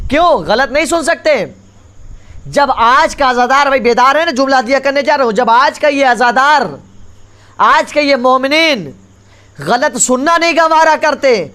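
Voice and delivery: impassioned sermon climax; full-force preaching. Acted. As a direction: preach it out to the whole hall.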